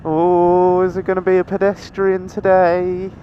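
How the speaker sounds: mock panic about a pedestrian; mock alarm. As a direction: fret theatrically at the road ahead.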